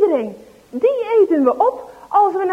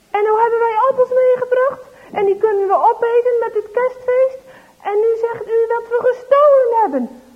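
much higher and louder, fast and heated; indignant boy; 8 semitones higher, 7 dB louder than usual. Acted. Dries protesting angrily to mother. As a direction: protest hotly, defending yourself. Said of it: "En hij is helemaal driftig".

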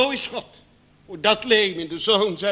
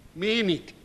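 normal talking voice

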